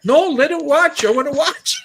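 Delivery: loud, high mock-eager objection; imitated eager protest. Other guy objecting to turning it off, mock-eager. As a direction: imitate someone desperate to keep watching.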